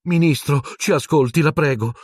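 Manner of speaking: high-pitched, earnest plea; pleading. A narrator's reading. Hermione imploring the minister to listen, pleading. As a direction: beg to be heard. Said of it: "con aria supplichevole".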